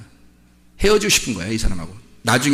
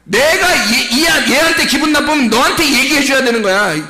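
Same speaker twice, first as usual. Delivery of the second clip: high, raised preaching voice; impassioned rebuke; 10 semitones higher, 5 dB louder than usual. Real, not acted. Preacher rebuking the congregation for grumbling, impassioned rebuke. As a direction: drive the scolding home at a high pitch.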